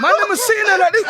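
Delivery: high, loud laughing yell; laughing shout. Real, not acted. Repeat, louder and higher.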